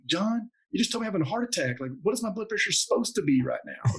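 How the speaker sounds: half-yell the disbelief at your doctor friend; retold yell at doctor. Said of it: "I'm yelling at my doctor".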